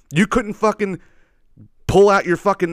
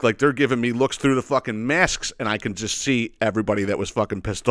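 ordinary talk, nothing marked

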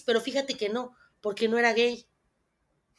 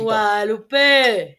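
slow, louder shout, vowels held; long calling shout; 8 dB louder, much slower than usual. Shown as a demonstration. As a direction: hold the vowel and call out loud. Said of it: "estamos practicando el grito".

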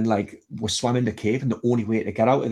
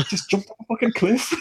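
incredulous; 12 semitones higher than usual. Real, not acted. Disbelief after the cliff jump.

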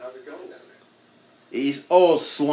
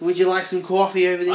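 higher-pitched mock New York accent; hammed-up New York accent; 6 semitones higher than usual. Shown as a demonstration. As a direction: put on New York for the coffee line. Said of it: "I can't do the New York accent".

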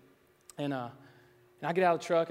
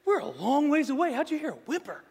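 mock disbelief; 11 semitones higher, 7 dB louder than usual. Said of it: "I'm like".

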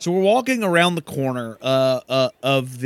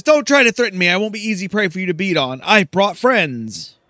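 defiant Silky; 6 semitones higher, 4 dB louder, faster than usual. Acted. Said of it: "and Silky says".

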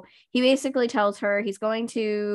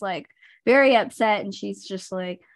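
normal talking voice

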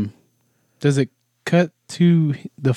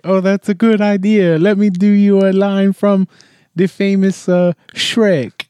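high, eager showman voice, much higher; Pistachio's eager voice; 8 semitones higher, 4 dB louder than usual. Retold or imitated. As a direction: pitch it up like a performer about to show off. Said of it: "He's like".

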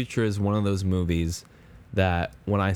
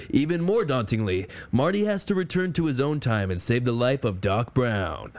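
higher, dramatic summer blockbuster narrator; movie trailer announcer; 4 semitones higher than usual. Pretend. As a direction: sell it like a big eighties trailer. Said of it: "give us your best, your greatest, your final summer movie announcer voice".

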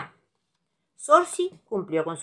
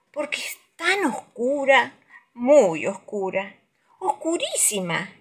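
spooky storyteller; 5 semitones higher than usual. A narrator's reading. Scary dark night, drawn out.

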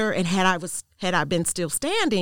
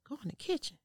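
very soft, raised pitch; hushed questioning echo; 8 semitones higher, 12 dB quieter, faster than usual. Retold or imitated. Woman echoing the voice's instruction to herself, hushed. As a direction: repeat it under your breath in doubt.

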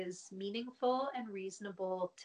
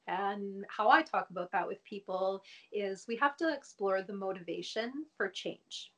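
ordinary delivery

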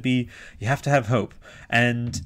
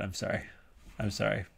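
ordinary talk

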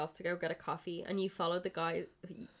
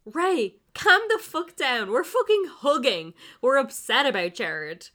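loud, high yelling while claiming to be calm; shouting, not calm; 6 semitones higher, 11 dB louder than usual. Acted. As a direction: shout down Ray, the opposite of calm. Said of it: "Frankie speaks calmly and clearly".

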